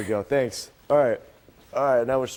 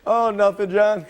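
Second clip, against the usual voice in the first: anchor stifling giggles; 6 semitones higher, 4 dB louder than usual. Real, not acted. Covering his laughter.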